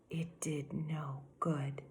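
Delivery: slow hushed whisper. A narrator's reading. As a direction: let it fall to a whisper. Narrator hushes story line.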